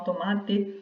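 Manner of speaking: tomate with a crisp final T and open E, Paraná style; final T pronounced. Shown as a demonstration. Teacher demos tomate with a hard final T. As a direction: say tomate ending in a clear T sound, not CH. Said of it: "porque eles usam o T no final".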